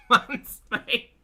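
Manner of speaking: high, loud burst through laughter; laughing. Real, not acted. Thanking a long-time chat member, laughing. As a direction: laugh your way through the words.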